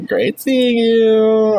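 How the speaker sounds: very slow, high singsong goodbye; drawn-out awkward farewell. Acted. Student awkwardly signs off to teacher, slow singsong. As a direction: stretch it out awkwardly.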